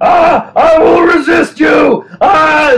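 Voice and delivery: high, loud, slow ranting yell; mock screaming. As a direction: howl it out like a man possessed.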